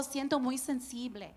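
quiet, plaintive self-pity; suave y quejumbrosa. Retold or imitated. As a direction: murmur it like you're fragile. Se queja con su esposo. (voz bajita y sentida)

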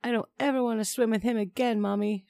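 put-on high-pitched, upset child's protest; child's yell, narrated. A narrator's reading. Child to mother after scare in pool, high upset yell. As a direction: cry out to mom without full volume. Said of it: "she yelled".

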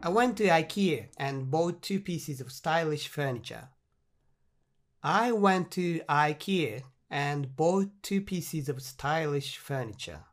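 very slow, clear English read-out; slow model reading. Shown as a demonstration. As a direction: spell each word out slowly for learners. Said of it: "回答はこちらです". (学習者向けにとてもゆっくり英文を読む)

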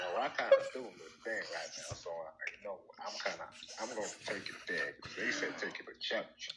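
low-pitched put-on American accent. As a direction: settle into a mock American accent. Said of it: "that's not his normal accent".

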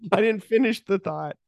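high put-on excuse; acted defensive squeak; flustered mock protest.

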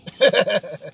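short burst of giggles at oneself; laugh. Real, not acted. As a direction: crack up briefly. Amused at own botched accent.

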